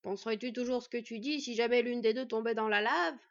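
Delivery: loud, gruff reproach; grumbling. A narrator's reading. Smith answering Fenn about the danger, grumbling. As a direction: grumble a pointed objection. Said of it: "ronchonna Nuri".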